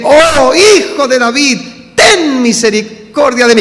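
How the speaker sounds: loud, high clamour for mercy; acted shout. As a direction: cry out to be heard, stretching the words. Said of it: "mira que yo grité". (clamor a gritos, actuado)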